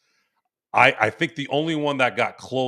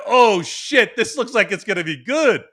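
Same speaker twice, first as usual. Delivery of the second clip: retold burst of excitement, high and loud; excited reaction; 7 semitones higher, 4 dB louder than usual. Man recalling his reaction to a movie trailer, excited. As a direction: relive a thrilled first reaction, pitched up.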